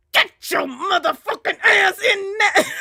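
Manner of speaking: high, strained mock scolding; furious mom imitation. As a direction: imitate mom tearing into the kid. Mom scolding after leaving school.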